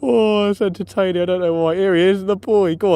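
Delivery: delighted. Real, not acted. Delighted watching the rat.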